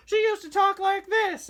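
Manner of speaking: very high, slow squeaky cartoon voice; like Mickey Mouse on helium; squeaky high Flo impression; old Flo voice. Shown as a demonstration. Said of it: "do my old Flo voice".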